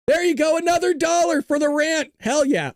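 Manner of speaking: high, hyped donation shout-out; excited cheering. Real, not acted.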